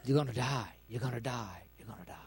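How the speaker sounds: low, hushed, insidious murmur; sinister whisper dying to almost nothing; devil's whisper. Acted. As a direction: whisper it into their ear, fading away. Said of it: "the devil will keep whispering in your ear".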